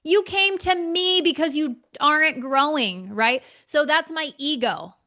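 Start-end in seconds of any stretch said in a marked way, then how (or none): 0.0-3.1 indignant outburst, pitched much higher; high, offended mock rant; ego flaring up. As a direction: let the bruised ego explode in disbelief. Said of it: "my ego for sure flared up".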